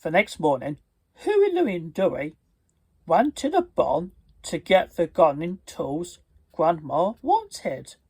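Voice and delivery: ordinary delivery, nothing marked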